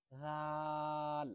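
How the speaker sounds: রাল held long, soft and thin; stretched soft letter. Shown as a demonstration. Teacher showing soft stretched letter to students. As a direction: draw রাল out softly. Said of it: "একেবারে পাতলা".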